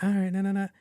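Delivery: high-pitched, brushing-off reply; mock reluctant friend. Homebody friend brushing off pals urging her out, mock reluctant.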